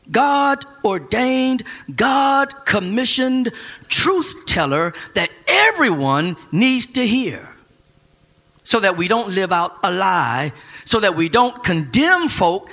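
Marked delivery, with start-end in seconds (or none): 0.1-7.5 very slow, weighted God-ordained; stretched emphasis. Real, not acted. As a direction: drag out God-ordained truth teller. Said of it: "God-ordained truth teller".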